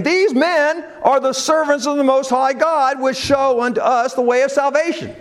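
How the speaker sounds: put-on a high, carrying call of the possessed girl; crying damsel. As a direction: lift it like a girl crying out after them. Possessed damsel cries after Paul.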